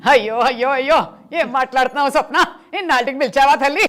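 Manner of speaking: put-on loud, high, fast old-actress voice with a laugh; Suryakantham impression. Mimicry artist on a talk show doing the actress Suryakantham, loud and high. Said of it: "అలా అనుకోకుండా సూర్యకాంతం గారు వచ్చేసారన్నమాట".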